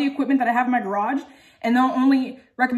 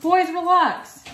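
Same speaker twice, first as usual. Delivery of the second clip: higher, slow call across the room to settle the dogs; 7 semitones higher, 4 dB louder, much slower than usual. Real, not acted. Owner calls to her rowdy dogs, a slow raised call. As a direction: draw it out, calm them down from afar.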